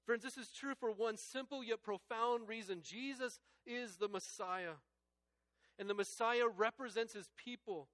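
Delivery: loud, high, impassioned preaching; rising fervent sermon climax; full-force preaching. Real, not acted. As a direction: lift it up and proclaim each question.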